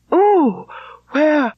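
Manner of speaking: high, urgent cry for her uncle; anxious cry. A narrator's reading. Elizabeth cries out for her uncle in alarm. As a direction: call out in sudden distress. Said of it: "cried Elizabeth".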